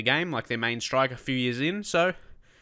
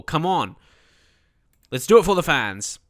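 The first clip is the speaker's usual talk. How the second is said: louder, higher pep talk to the team; rallying cry; 4 semitones higher, 7 dB louder than usual. Real, not acted. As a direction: fire up the squad. Rallying the team before the game.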